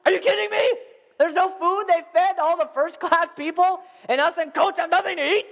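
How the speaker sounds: mock outrage. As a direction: whine about the food, outraged. Outraged passenger, no food.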